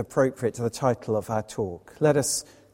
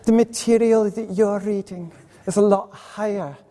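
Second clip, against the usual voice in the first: put-on higher-pitched old Scottish lady; imitated old lady's accent; 6 semitones higher than usual. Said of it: "forgive my accent".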